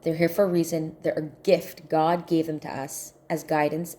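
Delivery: normal talking voice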